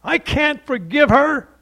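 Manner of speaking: imitating the husband's angry refusal; furious refusal. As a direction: dig in and shout it. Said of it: "And he became furious".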